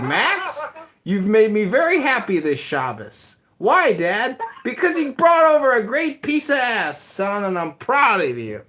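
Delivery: high, loud mock-dad voice, laughing into it; hammed-up proud father; imitating his dad. Son playing his dad praising him at Shabbos, high mock voice.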